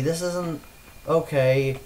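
usual speaking voice

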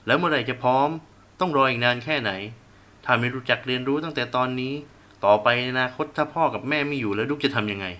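loud heated lecture to his son; angry berating. Acted. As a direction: hammer the questions home. Father berating son in quarrel. (พ่อดุลูกเสียงดัง)

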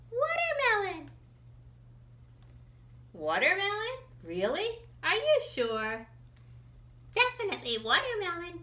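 high, fast, bouncing know-it-all; loud, high cartoon animal, very sure of itself; high excited melon expert. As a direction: sell the watermelon tip, high and eager.

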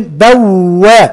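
بوأ said very slowly, the waw pressed hard and said twice; teacher shows the shadda in بوأ slowly; doubled waw stressed. Shown as a demonstration. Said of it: "بنتك عليها قوي وبننطقها مرتين".

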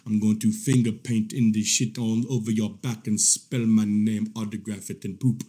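low, menacing mock creep voice; low-pitched parody of a filthy voice message; mock voice-message impression. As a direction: drop low and relish each threat, mocking him. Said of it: "sending voice messages talking about".

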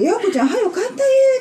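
high mock quote. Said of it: "って言うて言われて".

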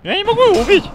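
high, loud exasperated yell; frustrated shout. Real, not acted. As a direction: yell it in disbelief. Can't kill tough monster.

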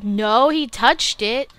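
loud, high, drawn-out protest; dismayed shout. Real, not acted. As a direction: cry out in dismay at the game. Protests a mishap in game.

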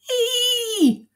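very high, squealed mock howl; high-pitched "Ee!" squeal; pained howl. A narrator's reading. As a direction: squeal it out like a storybook yelp. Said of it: "howled Mister McGee".

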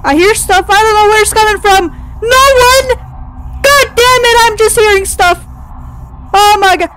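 high, loud, near-crying panic; real freaked-out fear; panicked fear. Real, not acted. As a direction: babble in rising terror. Panicked by unseen noises.

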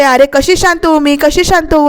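ordinary delivery, nothing marked

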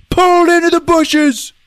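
high mock-alarmed yell; dramatic exclamation. Teller at the monster attack, high yelled climax. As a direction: burst into a high holler.

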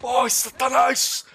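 high, loud, slow cry; drawn-out gamer yell; heated exclamation. Real, not acted. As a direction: stretch it out as a loud curse. Exclaims during firefight.

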